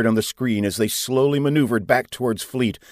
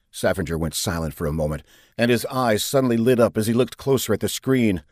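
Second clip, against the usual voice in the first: ordinary delivery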